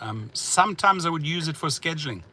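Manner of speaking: ordinary talk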